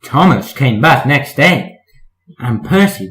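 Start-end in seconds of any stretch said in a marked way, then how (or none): none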